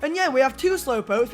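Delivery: ordinary talk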